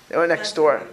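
ordinary talk

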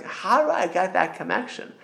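a smart person asks for that connection, lightly pleading; longing seeker. Retold or imitated. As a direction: ask with mild longing. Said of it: "a smart person would say".

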